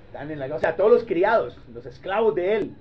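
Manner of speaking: ordinary talk, nothing marked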